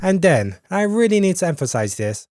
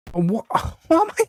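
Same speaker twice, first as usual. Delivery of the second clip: laughing disbelief; 12 semitones higher than usual. Real, not acted.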